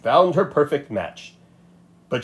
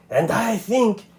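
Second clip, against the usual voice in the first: hissed; 10 semitones higher, slower than usual. A narrator's reading. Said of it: "he hissed".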